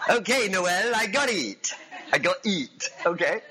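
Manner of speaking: comic French gunner, pitched high; hammed-up French accent, eager reply. Gunner answering his general, playful French accent. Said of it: "French accent, come on".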